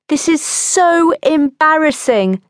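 high, drawn-out, mortified wail; slow wail of embarrassment; Phyllis wailing. A narrator's reading. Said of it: "Phyllis had wailed".

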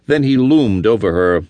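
usual speaking voice

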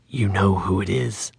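a sexless voice from the dark, quietly menacing; mysterious intruder answering from the shadows; neutral eerie voice. A narrator's reading. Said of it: "a neutral tone that resonated".

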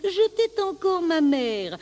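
high little lamb, falling away at the end; small high-pitched voice of innocence; protesting lamb. Acted. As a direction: plead high, as a helpless lamb. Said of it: "reprit l'agneau".

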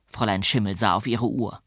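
ordinary delivery

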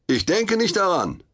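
defiant call from above; calling back down. A narrator's reading. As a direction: call down from the trapeze, brushing him off. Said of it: "rief ich zurück".

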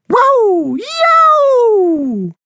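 very high, loud yowl; cat yowl. Acted. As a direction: wail like a tomcat at night. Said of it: "a caterwaul symphony".

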